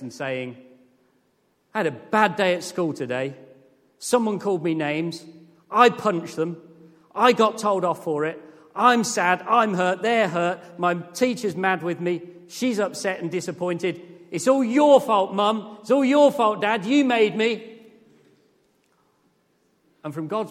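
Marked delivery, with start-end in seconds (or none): 1.7-14.0 put-on louder and higher, a hurt kid's complaint; a child telling their parents about school; sulky child. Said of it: "like a child going to their parents". 14.3-17.7 much higher and louder, wailing accusation; a kid throwing the blame at Mum and Dad; child yelling it's all your fault; child's whining blame. Retold or imitated. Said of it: "like a child going to their parents".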